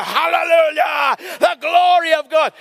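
slow, loud, high hallelujah shout; hammed-up praise; joyful shout. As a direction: cry it out of the car window. Shouting out the car window.

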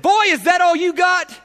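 high, loud triumphant taunt; Jesus taunting Satan in hell; defiant shout. Retold or imitated. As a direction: throw it in Satan's face. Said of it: "and he said".

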